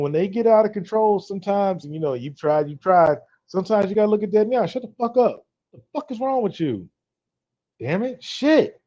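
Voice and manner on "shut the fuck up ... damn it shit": sharp, high-pitched telling off; angry outburst. Shown as a demonstration. Man snapping at his emotional girlfriend, angry outburst. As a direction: snap it out like you're fed up. Showing how to tell her off.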